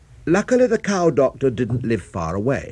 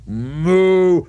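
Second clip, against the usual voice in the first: loud moo; 7 semitones higher, 7 dB louder than usual. Acted. Cow answers the specialist.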